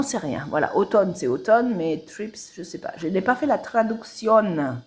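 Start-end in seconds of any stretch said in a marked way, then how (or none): none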